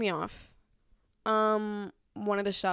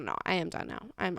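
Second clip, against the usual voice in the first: ordinary talk, nothing marked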